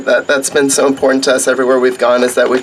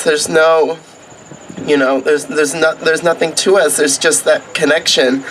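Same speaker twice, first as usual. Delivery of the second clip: ordinary talk, nothing marked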